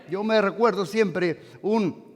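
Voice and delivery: ordinary talk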